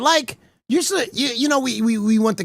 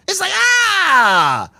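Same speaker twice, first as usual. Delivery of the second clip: stretched, disgusted groan of revulsion; grossed-out groan; 6 semitones higher, much slower than usual. Real, not acted. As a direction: drag out the ah like you touched something slimy. Disgust at sweaty handshake.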